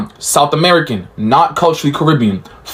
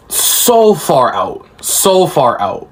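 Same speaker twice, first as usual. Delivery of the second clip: "So far out" stretched very slowly; drawn-out emphasis; much slower than usual. Real, not acted. Showing the countries on a map, "So far out" slow and drawn out. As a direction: drag out every word of "So far out".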